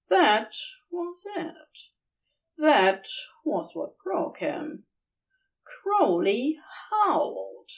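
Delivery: ordinary delivery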